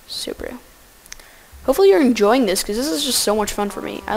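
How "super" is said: dry whispered sarcasm; mock praise, whispered flat; sarcastic whisper. Real, not acted. Sarcastic aside, unvoiced.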